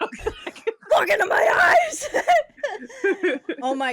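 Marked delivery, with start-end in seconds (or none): 0.0-3.6 high slow laughing yell; hammy shout. Acted. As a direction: boom it theatrically through laughter. Playful camera shout.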